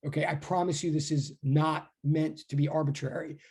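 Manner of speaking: ordinary talk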